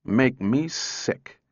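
slowly and deliberately. Acted. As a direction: drag each word out. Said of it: "said Powell slowly".

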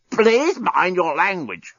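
slow, croaking robot reproach; croaked. A narrator's reading. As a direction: croak out a prim rebuke. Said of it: "croaked the despot".

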